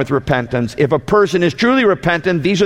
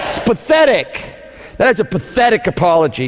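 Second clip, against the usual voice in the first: disdainful; 4 semitones higher than usual. Real, not acted. Preacher answering his own question with disdain. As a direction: spit out pathetic with disdain. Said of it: "say it with disdain".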